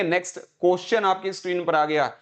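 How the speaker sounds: क्वेश्चन said as 'kos-chun'; imitated mispronunciation. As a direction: mimic others saying क्वेश्चन with a 'ko' start. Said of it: "या वो बोलेंगे". (क्वेश्चन, 'कोश्चन' वाला गलत उच्चारण)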